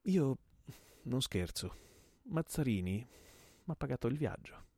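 twisted-mouth menace. Acted. Gunman telling his host who paid him, contorted menace. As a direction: say it through a twisted mouth, pausing between phrases. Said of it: "dice con la bocca scontorta".

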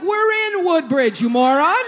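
acted indignant yell; exasperated retort. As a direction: stretch it out loudly as if to an idiot.

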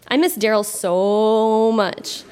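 a hammed-up sigh of longing, stretched; mock lovesick, very slow. Reading her teen diary to the crowd, mock lovesick and very slow. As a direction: drag out every word like a swooning teen.

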